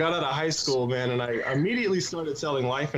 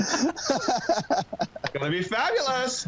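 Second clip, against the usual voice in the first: drawn-out, much higher camp voice; campy fabulous; 10 semitones higher, much slower than usual. Pretend. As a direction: stretch fabulous out slowly and high, camp it up.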